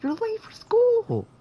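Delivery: very high, loud, slow mock-mom shout; hammed-up mom yelling from the hallway. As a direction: screech it like an angry mom waking her kid. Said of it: "your mom's saying".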